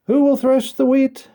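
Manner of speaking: high, loud hopeful calling out. A narrator's reading. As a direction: call out full of hope. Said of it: "in a very hopeful tone".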